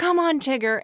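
put-on will the toddler to win, pushing hard; cheering on. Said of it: "I'm thinking".

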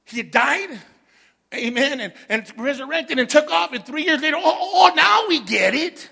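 loud, high, rising preacher's cry; fervent preacher calling the church to see; full-force preaching. Acted. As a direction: build it like a Sunday climax. Preaching climax on resurrection.

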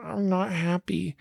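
soft, slow, sulky; mopey. Retold or imitated. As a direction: sulk it out quietly. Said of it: "But when we're like".

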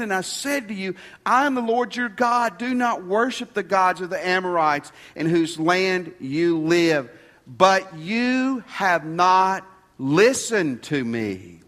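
emphatic divine rebuke, building to a slow close; forceful scripture reading. Acted. Preacher reading God's rebuke to Israel, proclaiming forcefully. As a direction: proclaim it, stretching the final reproach.

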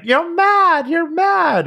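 high, loud mock shriek; freaking out. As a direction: shriek the accusation like a child freaking out. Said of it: "And she freaks out".

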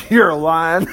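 words broken up by real laughter; laughing. Real, not acted. Preacher voicing Ahab's reply to the prophet, laughing. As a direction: laugh through the quote. Cracking up while voicing Ahab.

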